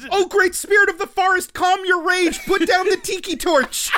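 high, loud, solemn mock-heroic appeal; Ashitaka imploring voice. Hero calming a raging forest spirit, imploring. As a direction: beseech the great spirit, hammed up. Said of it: "that Ashitaka imploring voice".

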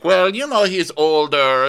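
high, slow mom impression. Mom voiced in retold story.

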